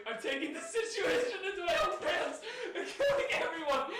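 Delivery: giggling through the whole line; laughing. Real, not acted. Reading absurd game text, cracking up.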